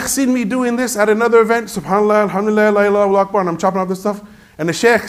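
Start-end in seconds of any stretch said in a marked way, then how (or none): 1.7-3.4 quick, higher-pitched run of phrases; busy chant while working; rapid-fire dhikr. Retold or imitated. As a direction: rattle it off without a break. Re-enacts his rapid dhikr while chopping.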